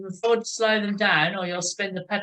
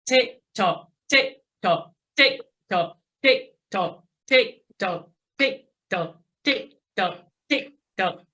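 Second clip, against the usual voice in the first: tighter, quicker metronome tick-tock; quick hundred-ten count. Shown as a demonstration. Coach pushes riders up to a hundred and ten, quick count. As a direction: drive an even, quick pedalling beat. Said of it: "go up to a hundred and ten".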